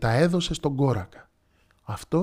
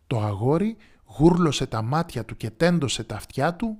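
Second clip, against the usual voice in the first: usual speaking voice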